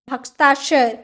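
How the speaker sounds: ordinary talk, nothing marked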